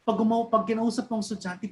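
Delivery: usual speaking voice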